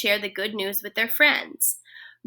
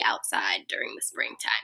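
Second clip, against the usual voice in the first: sudden whisper; 7 dB quieter than usual. Real, not acted. Teacher drops to a whisper.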